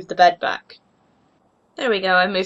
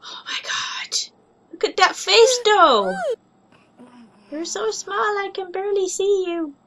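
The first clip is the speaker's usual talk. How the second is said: awed cooing; 8 semitones higher than usual. Real, not acted. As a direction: whisper then high coo. Melting over tiny kitten.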